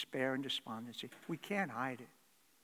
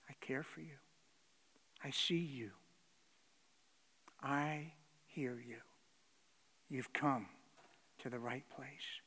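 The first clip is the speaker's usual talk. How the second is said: tender reassurance; 2 semitones lower, 6 dB quieter than usual. Retold or imitated. God's tender reply.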